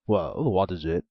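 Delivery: a loud, gruff ogre; the ogre snaps back; an ogre answers the maiden, loudly. A narrator's reading.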